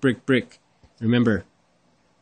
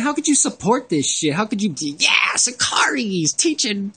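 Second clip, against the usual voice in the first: higher, strained outrage; outraged rant; 8 semitones higher than usual. Real, not acted. Ranting at idolaters, voice rising in outrage. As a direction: rise into an exasperated cry.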